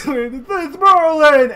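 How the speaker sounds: loud, slow, high call; excited shout. Acted. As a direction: draw out the name with glee. [name] repeats the greeting.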